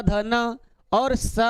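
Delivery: ध, न and स drawn out slowly, each letter apart; slow, deliberate listing of ध, न and स; slow letter demo. Shown as a demonstration. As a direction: recite ध, न, स slowly for the class. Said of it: "ये दंती वाला स".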